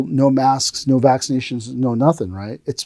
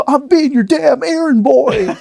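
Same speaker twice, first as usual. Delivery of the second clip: high, drawn-out comic outburst; hammed-up protest in a put-on voice; mock indignant; 14 semitones higher, slower than usual. Fed-up student complaining to his master, mock indignant.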